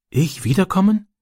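high, timid question in broken German; shy boy's question. Acted. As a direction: ask timidly like the small boy. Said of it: "fragte er schüchtern". (schüchtern, hoch und zaghaft)

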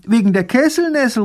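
high, loud eager call; the little duke interrupts with a high, loud call. A narrator's reading. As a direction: blurt it out, cutting in. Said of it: "ruft der kleine Großherzog".

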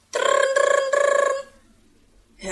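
high rolled trill like a doorbell; long buzzing "drrrn" of a bell; doorbell ring. A narrator's reading. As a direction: imitate a ringing bell, loud and high. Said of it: "Hört er es im Haus läuten".

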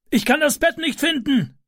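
high call from inside the smoky room; man calling down from the window. A narrator's reading. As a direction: shout down to the people below. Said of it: "rief er nach unten".

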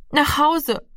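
hoher, eiliger Ausruf eines Jungen; schneller Ausruf. A narrator's reading. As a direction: rufe es schnell, deutlich höher. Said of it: "rief Rami schnell". (schnell und hoch gerufen)

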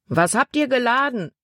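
projected question from the shore; man calling out. A narrator's reading. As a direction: call up to the deck. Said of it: "rief ein Mann".

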